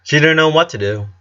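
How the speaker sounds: usual speaking voice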